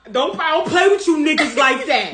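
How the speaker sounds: loud, high mock telling-off. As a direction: act out an indignant singer setting them straight. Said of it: "[name] be like".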